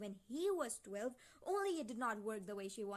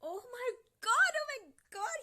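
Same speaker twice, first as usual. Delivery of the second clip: very high, loud shriek of disbelief; real horrified squeal; shocked outburst; 13 semitones higher, 12 dB louder than usual. Real, not acted. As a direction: cry out, appalled, pitch shooting up. Shocked reaction to book revelation.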